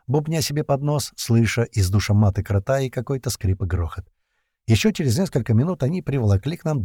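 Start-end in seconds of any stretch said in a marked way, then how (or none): none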